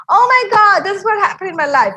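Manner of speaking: high, loud "oh my God", then rushing fast; surprised delight. Retold or imitated. Teacher acting out finding her old diary, surprised and excited. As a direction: gasp of happy discovery. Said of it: "but suddenly I'll see".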